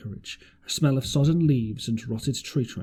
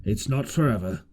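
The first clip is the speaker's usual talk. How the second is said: a lightly voiced surly seaman, cursing under the oath; a hint of a grumbling sailor's voice; 4 semitones lower than usual. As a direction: mutter it like a sour deckhand. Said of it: "he said with an oath".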